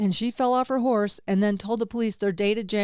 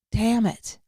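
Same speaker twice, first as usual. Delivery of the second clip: usual speaking voice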